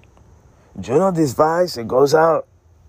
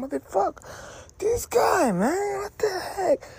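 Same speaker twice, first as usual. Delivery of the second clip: high wheezing words through tears of laughter; helpless tearful laughter; crying laughing; 6 dB quieter than usual. Real, not acted. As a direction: squeak it out while sobbing with laughter.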